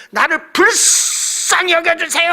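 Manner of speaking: high, slow, straining cry; pushed-out plea. Retold or imitated. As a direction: push the voice out so it carries far. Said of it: "소리가 더 퍼지라고 밀었어".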